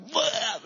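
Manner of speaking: mock retching bleh; burp-retch noise. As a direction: make the queasy half-burp sound. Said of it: "We burped a little bit".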